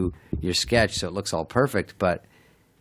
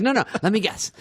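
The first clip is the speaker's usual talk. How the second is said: much higher mock waiter, playful; cocky waiter; 13 semitones higher than usual. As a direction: tease it out like a smug waiter. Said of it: "He goes".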